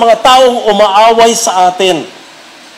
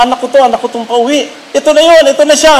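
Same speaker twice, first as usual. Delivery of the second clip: excited father; 7 semitones higher, faster than usual. Retold or imitated. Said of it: "Excited siya".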